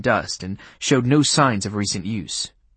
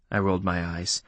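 usual speaking voice

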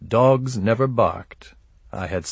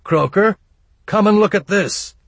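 high, loud call for attention; One Eye yelling; 11 semitones higher, 5 dB louder than usual. A narrator's reading. One Eye calls Croker back from the rear, yelling. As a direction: call out urgently, voice pitched way up. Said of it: "I heard One Eye yell from the rear".

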